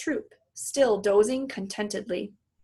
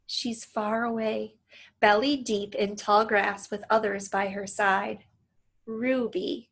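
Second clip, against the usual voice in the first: usual speaking voice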